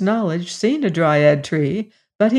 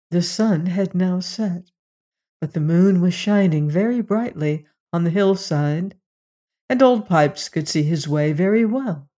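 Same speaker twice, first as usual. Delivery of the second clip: ordinary delivery, nothing marked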